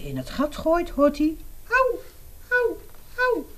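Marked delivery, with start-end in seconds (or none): 1.7-3.5 high squeaky ouch from under the ground; a small creature yelping when something hits it; pained little cry. Acted. Mole hit by an acorn, crying out high and squeaky.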